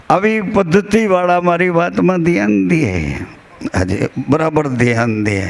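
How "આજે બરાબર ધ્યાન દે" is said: ordinary talk, nothing marked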